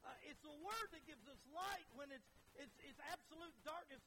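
rhythmic, emphatic pulpit refrain; fervent preaching. Real, not acted. Preacher praising what the word gives us, fervently. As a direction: drive home each repeated phrase.

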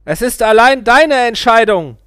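the guru calling back as he flees. A narrator's reading. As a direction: call it up from below. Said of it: "rief er".

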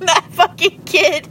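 choked with laughter; squeaking words out through laughter; laughing through words. Real, not acted. Laughing fit mid-story.

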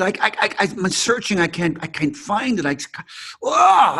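high, stammering, ending in a cry; imitated frustrated breakdown. Actor in class failing to feel it, stammering into a cry. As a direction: mimic the actor cracking up. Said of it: "He said".

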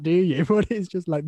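ordinary delivery

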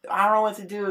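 put-on high-pitched helpless kid; bored kid complaining. Retold child complaining to mom.

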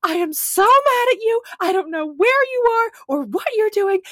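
high, loud mock-furious voicemail rant; imitating angry friend. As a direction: play the livid friend leaving a voicemail. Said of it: "it is Christy going".

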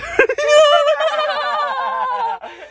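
howling laugh at own joke; shrieking laugh. Real, not acted. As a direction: burst into shrill laughter.

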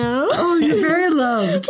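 high tender coo; affectionate. Real, not acted. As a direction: coo it lovingly.

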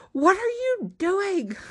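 high, drawn-out disbelief; incredulous outcry. Acted. Will reacts to the screaming.

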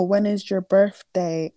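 normal talking voice

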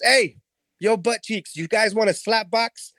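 brash taunt from a wannabe hero; cocky hero taunt. Retold or imitated. EP taunting the henchman, cocky and high-pitched.

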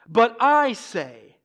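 slow and loud, I drawn out; weighty, held stress on I; emphatic I. Retold or imitated. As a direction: punch and stretch the word I. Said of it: "The I is emphatic".

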